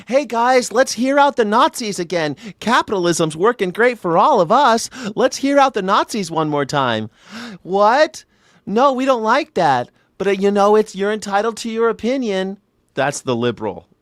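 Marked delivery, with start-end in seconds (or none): 0.1-7.1 high, naive, chirpy mock liberal; pitched way up, gushing and gullible. Mocking liberals to his chat, high and naive. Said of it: "Liberals are like". 7.6-12.6 high, mild, polite mock liberal; pitched up, meek and accommodating. Mocking liberals to chat, high and meek.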